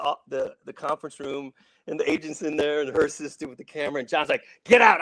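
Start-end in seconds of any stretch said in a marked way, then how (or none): 4.6-5.0 loud high bark of "Get out!"; retold shout. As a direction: chase them out of the room. John yelling the agent out.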